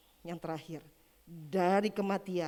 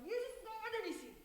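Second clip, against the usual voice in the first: soft, high, thin imitated cry; hushed cry to Jesus; 6 dB quieter than usual. Preacher voicing a convert in worship, hushed high cry. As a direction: act out a distant, awed cry, held back. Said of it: "dia katakan".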